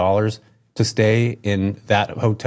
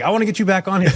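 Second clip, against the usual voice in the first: much higher, eager retold invite; eager quote; 9 semitones higher, faster than usual. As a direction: pitch the quote up high, keen to ask.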